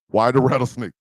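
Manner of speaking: ordinary talk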